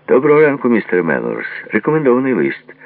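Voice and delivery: high, loud postman's hail; calling out. A narrator's reading. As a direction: call up a cheery morning greeting. Postman calls out a greeting.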